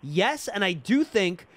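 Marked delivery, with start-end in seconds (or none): none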